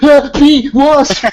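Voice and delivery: high, loud startled yelp; hammed-up fright; mock panic. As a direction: jump at a flying bug. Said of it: "We'd be like".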